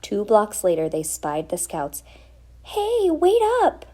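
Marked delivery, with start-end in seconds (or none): 2.6-3.9 high, slow, loud call; yelling. Acted. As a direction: call after the departing scouts. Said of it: "yelled the baby's mother".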